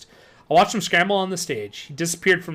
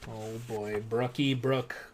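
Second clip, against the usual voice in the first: eerie whisper; 6 semitones lower, 9 dB quieter, much slower than usual. Acted. Eerie voice in silence.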